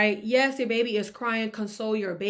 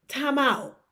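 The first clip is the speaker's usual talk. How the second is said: ordinary delivery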